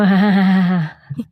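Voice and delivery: loud spooky villain laugh; hammy Halloween cackle; creepy cackle. Acted. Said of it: "That's a really not good".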